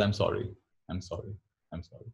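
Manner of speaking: hushed repetition, fading away; softly repeated apology. Shown as a demonstration. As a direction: repeat it softly, trailing into near silence. Murmuring apology to stack of bills.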